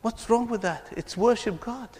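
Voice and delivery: normal talking voice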